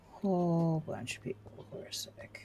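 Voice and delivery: repeats it in a whisper; whispered aside. Shown as a demonstration. Gamer whispering the line back to herself. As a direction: breathe the words out without voice. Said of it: "repeated unvoiced".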